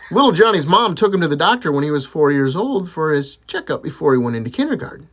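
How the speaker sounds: ordinary talk, nothing marked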